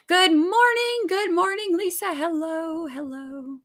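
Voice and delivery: high, slow lilting good morning; singsong greeting. Real, not acted.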